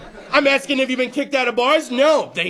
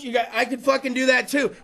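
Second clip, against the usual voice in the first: usual speaking voice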